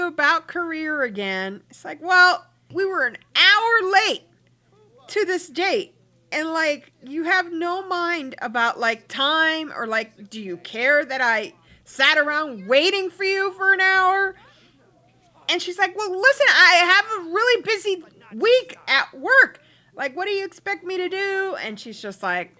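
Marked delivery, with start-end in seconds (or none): 2.0-14.4 high-pitched indignant rant, a touch louder; mock angry complaint. Girlfriend complaining to her boyfriend about waiting an hour. Voiced Sims argument. 16.0-21.6 high, louder defensive reply, speeding up at the end; mock defensive retort. Boyfriend defending his busy work week to his girlfriend. Voiced Sims argument.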